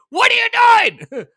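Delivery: loud, high incredulous shout, slowed; Mitch McConnell. Retold or imitated. McConnell voiced scolding the cut plan, incredulous shout. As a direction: yell in disbelief at the plan. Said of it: "Mitch McConnell's like".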